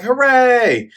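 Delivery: mock crowd cheer, high and loud; hammed-up hooray. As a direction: shout it like a rowdy crowd.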